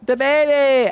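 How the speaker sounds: sudden high, loud cry of delight; excited squeal. Real, not acted. Streamer squealing at a viewer's message.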